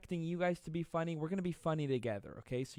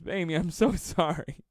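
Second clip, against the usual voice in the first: higher and louder, laughing through sorry; laughing apology; 4 semitones higher, 8 dB louder than usual. Real, not acted. To commenter Amy after his joke, laughing. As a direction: apologize while cracking up.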